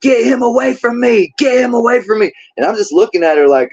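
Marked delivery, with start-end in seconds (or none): 0.0-2.3 retold scream. Prosecutor shrieking at detectives about the defendant, mock scream. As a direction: imitate a frantic screamer in a much higher voice. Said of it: "just starts freaking out and screaming".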